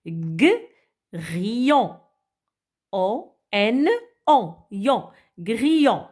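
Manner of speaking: "Grillon" split into "O N O", "Yon", then whole; sounding out. Shown as a demonstration. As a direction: decompose "Grillon" slowly and rebuild it. (décomposer "Grillon" puis le relire)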